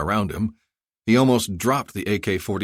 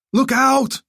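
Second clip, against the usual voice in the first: much higher, louder warning cry; alarmed cry; 7 dB louder than usual. Acted. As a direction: warn of danger. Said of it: "someone cried".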